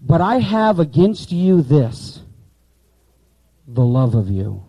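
slow and loud, landing hard on "the love of you"; emphatic word order. Shown as a demonstration. Teacher showing students the emphasis, slow and loud. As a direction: lean on "the love of you" as the point. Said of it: "And watch the emphasis".

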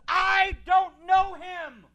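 high, slow, shouted denial; frantic denial. Acted. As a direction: scream it out in fear. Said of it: "And he says".

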